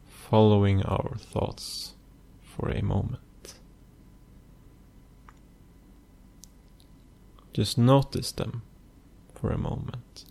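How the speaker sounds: soft, unhurried meditation voice with long pauses; hushed, even delivery, letting phrase ends fade; guided meditation. Acted. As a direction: guide the listener gently into stillness, phrase by phrase.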